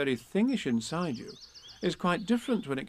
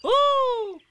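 very high, loud little squeal; a tiny kangaroo bursting with excitement; excited squeal; 9 dB louder than usual. A narrator's reading. Roo squeals at Eeyore's news, high and excited. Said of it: "said Roo excitedly".

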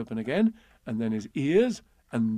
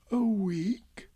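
high, whispery dismay; faint, pitched-up gloomy question; 9 semitones higher than usual. A narrator's reading. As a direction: breathe it out forlornly. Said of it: "said Pooh gloomily".

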